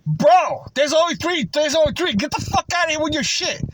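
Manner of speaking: mock angry customer. Customer tells the vlogger to get out, angry imitation.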